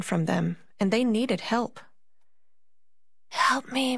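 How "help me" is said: put-on a high, far-off child's plea; ghostly plea. A narrator's reading. Mysterious child begging Marie for help. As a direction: call for help like a lost child. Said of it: "they said".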